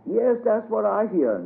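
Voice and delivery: put-on an old man agreeing. A narrator's reading. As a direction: Uncle Bob agreeing. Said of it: "said Uncle Bob".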